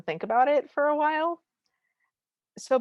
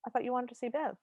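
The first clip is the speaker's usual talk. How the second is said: soft voice after the racket; hushed, slightly high reply; suddenly quiet; 4 semitones higher, 7 dB quieter, faster than usual. Retold or imitated. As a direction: drop to a quiet, puzzled question. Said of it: "immediately stops making all sounds".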